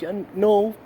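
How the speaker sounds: defiant, loud and high. Acted. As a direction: push back loudly and high. Defiant reply to himself.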